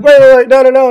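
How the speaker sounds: loud, high panicked protest; panicked retold shout. Stoned friend yelling in panic at the joint passers. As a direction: cry out wait in alarm. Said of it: "And I'm like".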